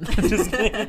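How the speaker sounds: high giggling quip; laughing. Real, not acted. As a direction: laugh it off lightly. Laughs after joke, +10 st.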